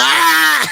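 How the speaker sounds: loud, high-pitched mock scream; reenacted scream. Man retelling his run from cave bats, mock screaming. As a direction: scream out long and high, acting out the panic. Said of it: "I'm screaming".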